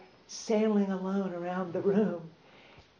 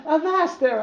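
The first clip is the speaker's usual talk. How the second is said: high, loud laughing shout; cried out; 8 semitones higher, 9 dB louder than usual. A narrator's reading. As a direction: yell it out through laughter. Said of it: "I cried".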